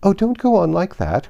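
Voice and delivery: high, loud, anxious cry; crying out in despair. Acted. Queen begging Alice not to cry, high anxious cry. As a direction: wring your hands and plead. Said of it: "cried the poor Queen".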